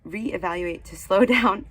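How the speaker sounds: hurried, tripping over words; reading too fast, stuttering; rushed stumbling reading. Real, not acted. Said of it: "I'm stuttering over my words 'cause I'm trying to speak so fast".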